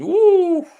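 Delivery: a sudden high squeal of excitement; watching a late chance on stream, a high excited ooh. Real, not acted. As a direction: yelp as the chance opens up. Near chance in the final minutes.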